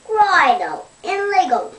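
eager. Pretend. Dingo eagerly urges.

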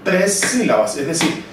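usual speaking voice

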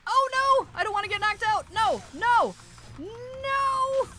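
high, loud panicked cries of no; panicked yelling. Real, not acted. Gamer yelling in panic at attacking creatures, high and loud. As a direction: yell in real panic as the monster closes in.

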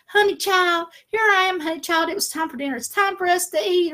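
put-on high, loud campy honey child voice; hammed-up high diva impression of the son; son's sassy voice. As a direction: flounce in like a diva calling for dinner. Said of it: "he's talking like this".

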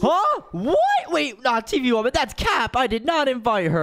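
high, startled protest; alarmed denial. Acted. Accused man protests to wife.

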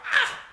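short mock shriek; sudden storyteller's cry; re-enacted scream. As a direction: yelp on impact, acting it out. I'm screaming.

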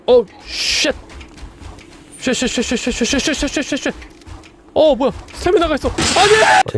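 high panicked shrieks; panicked screaming. Real, not acted. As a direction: yelp in real fright while fleeing. 괴물에게 쫓기며 비명. (겁에 질린 비명)

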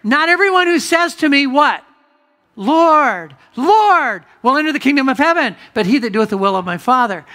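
high, loud proclaiming; preacher thundering Jesus' warning, loud and high; preaching at full force. Acted. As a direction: ring it out to the congregation. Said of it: "he says here".

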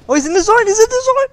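very high, loud squeal; shrieky, high-pitched gushing; excited squeal. Real, not acted. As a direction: squeal with delight over someone adorable. Gushing over cute default player.

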